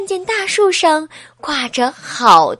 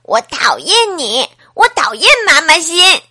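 loud, tearful outburst; shouting, in tears; 4 semitones higher, 8 dB louder than usual. Acted. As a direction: yell it at the teacher, crying. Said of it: "大声地对老师说". (阿志哭着对老师大喊)

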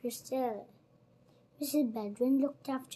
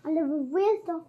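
ordinary delivery

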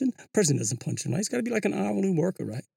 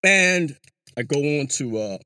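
ordinary talk, nothing marked

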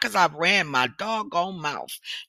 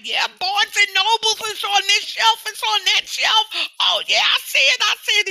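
pumped up; 11 semitones higher than usual. Retold or imitated. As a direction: shout like you can see your book on the shelf. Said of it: "I was like".

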